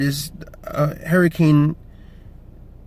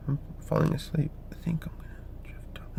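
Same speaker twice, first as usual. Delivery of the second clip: drowsy whisper, much softer; nodding off; 14 dB quieter than usual. Pretend. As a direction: pretend to drift off to sleep. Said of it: "No, not really".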